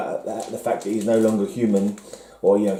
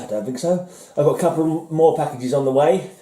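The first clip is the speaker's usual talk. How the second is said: normal talking voice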